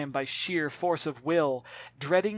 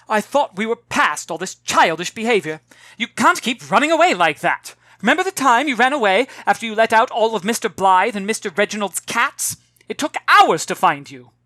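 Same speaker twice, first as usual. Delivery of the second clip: loud, higher, fast-paced telling-off; exasperated scolding; 6 semitones higher, 10 dB louder than usual. Acted. Mother lecturing her daughter about running away, loud and exasperated. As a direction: rattle through her past misdeeds in exasperation.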